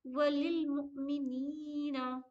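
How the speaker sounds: very slow, नी in मुमिनीना drawn out; stretched नी. Shown as a demonstration. Teacher modelling the long नी. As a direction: read वलिल मुमिनीना slowly, holding नी. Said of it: "नी को खींचेंगे".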